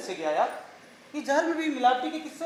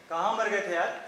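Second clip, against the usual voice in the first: ordinary delivery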